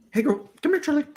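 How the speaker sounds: high cooing pet voice; dog voice. Shown as a demonstration. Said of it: "Can we hear it?".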